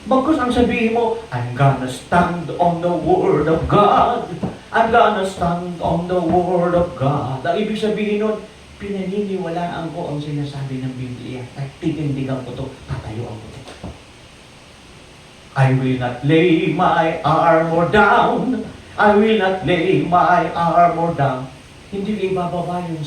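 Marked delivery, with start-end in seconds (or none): 1.3-7.4 slow, drawn-out declaration of faith; bold declaration. Acted. Preacher proclaiming a stand on God's word, bold and drawn out. 15.5-21.4 very slow, drawn-out proclamation; defiant declaration. Acted. Preacher vowing to keep the armor on, defiant and drawn out.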